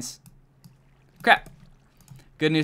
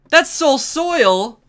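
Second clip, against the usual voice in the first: disbelief; 11 semitones higher, 7 dB louder, much slower than usual. Real, not acted. Finds wrong block type.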